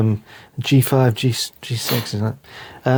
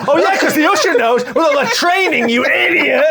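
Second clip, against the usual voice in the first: sarcastic laughing yell. Retold or imitated. Friend imitating Ben Stiller's sarcastic rant, shouting. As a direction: sneer then snap the insult. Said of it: "The usher told us".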